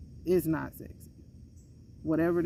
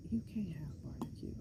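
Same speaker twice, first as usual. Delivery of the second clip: hushed whisper; 10 dB quieter, faster than usual. Retold or imitated. Nurse telling the patient no barbecue, in a whisper. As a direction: whisper it like a secret warning. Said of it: "And she was like".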